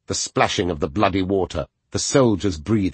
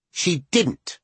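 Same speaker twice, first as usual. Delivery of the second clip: put-on child voice; 10 semitones higher than usual. Child defends his mother.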